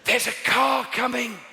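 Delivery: put-on high, drawn-out yell to a child; hammed-up parental holler; warning shout. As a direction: shout an urgent warning across a street. Demonstrates shouting at children in danger.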